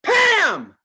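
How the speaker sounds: high, loud shout of "Pam!"; shouted name. Retold or imitated. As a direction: yell the random name at the ride. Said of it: "looked at the ride and said".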